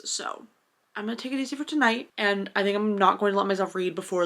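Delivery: usual speaking voice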